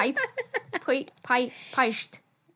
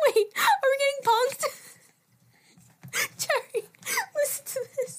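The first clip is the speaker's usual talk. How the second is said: high giggly voice; giggling disbelief; 11 semitones higher, faster than usual. Real, not acted. She cracks up over the voice message. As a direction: laugh through the words.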